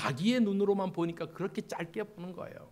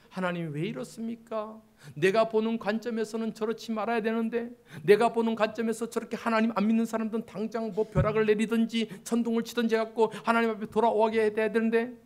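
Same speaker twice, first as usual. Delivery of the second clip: a touch of higher-pitched complaining; mild grievance; 5 semitones higher, 4 dB louder than usual. Acted. Believer telling God how unbelievers should be punished, mild complaint. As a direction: lightly protest to God with your own opinion. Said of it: "이런 마음이 있잖아요".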